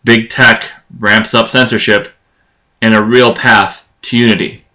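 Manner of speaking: measured, punchy show-opener headlines; headline intro read. Acted. Host teasing this episode's topics to listeners, headline intro read. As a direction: announce the episode's topics in separated, deliberate phrases.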